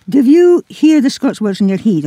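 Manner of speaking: ordinary talk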